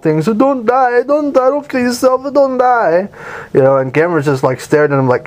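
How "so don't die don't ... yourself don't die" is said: put-on much higher, begging little boy; imitating a little kid begging Gamera not to die, high; pleading kid. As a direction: plead like a crying child. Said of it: "the little kid is going on and on".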